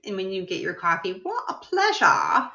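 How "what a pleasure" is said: very high, slow put-on British accent; mock British accent. Daring her to say it in coffee shops, mock British accent. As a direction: sing it out slowly in a hammed-up British accent. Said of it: "I say it with that accent".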